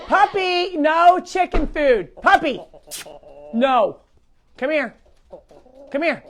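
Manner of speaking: stern owner warning the dog off; scolding the puppy. Real, not acted. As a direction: owner stopping the puppy from eating chicken food.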